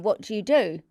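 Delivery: ordinary talk, nothing marked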